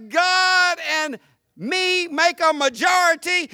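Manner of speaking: confident, ringing proclamation; bold faith. Acted. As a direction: proclaim it slowly and boldly. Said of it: "The voice that says".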